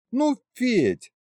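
high, nudging female voice; chiding wife. A narrator's reading. Wife nudging her husband, high and chiding. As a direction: chide in a high wife's voice. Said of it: "ткнула меня кулачком в бок Варя".

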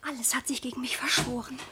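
whispered, hopeless aside; despairing whisper. Acted. Daughter despairs privately about her forced engagement, whispering. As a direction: breathe it to yourself in despair.

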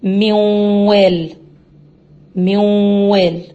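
موال drawn out slowly with a long nasal hum; slow nasal merge. Shown as a demonstration. Teacher demonstrating ghunna with waw, slow. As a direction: stretch موال, humming the nun into the waw. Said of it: "كلمة الواو".

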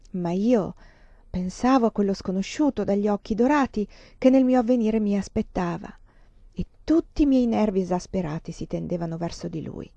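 ordinary delivery, nothing marked

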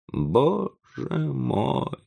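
high, drawn-out moan of dismay. A narrator's reading. Professor collapses on the table, moaning. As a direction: groan it out with a break between words. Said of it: "простонал он".

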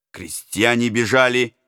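ordinary delivery, nothing marked

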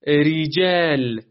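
ریجل slow and drawn out, with a light, thin ر; thin ra demo. Shown as a demonstration. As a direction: stretch out ریجل and keep the ر thin. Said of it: "اون وقت نازکه".

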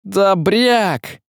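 angry shout. A narrator's reading. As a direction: yell across the crowd. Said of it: "закричал он".

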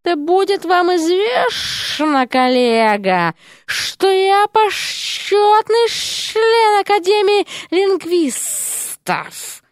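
high, slow haughty hiss; haughty snake lady. A narrator's reading. Серпентина boasts to her colleague, haughty. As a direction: lord it over him.

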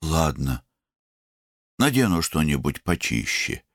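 mildly sullen reply. A narrator's reading. Younger brother giving in to his brother, mildly sullen. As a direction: give in grudgingly, without lifting the voice. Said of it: "угрюмо ответил ему Варун".

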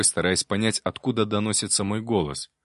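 ordinary delivery, nothing marked

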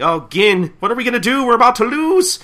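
high, loud panicked cry; yelling. Acted. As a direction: yell for help as defeat looms. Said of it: "Shinpachi's just yelling".

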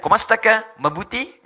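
put-on Tagalog-accented greeting, rising at the end; Filipino accent. Showing Indonesians can sound Filipino, imitated Tagalog accent. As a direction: imitate a Filipino asking after someone. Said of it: "kayak orang Filipin".

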